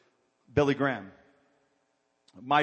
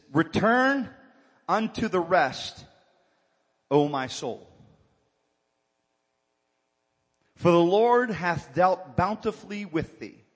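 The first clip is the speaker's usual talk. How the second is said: scripture read; 2 semitones higher, 4 dB louder than usual. Acted. Reading scripture with emphasis.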